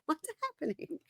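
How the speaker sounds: tiny, high and soft squeak; mock bewilderment. Friend baffled by the couple's quarrel, squeaky mock bewilderment. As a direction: trail into a very high, quiet disbelief.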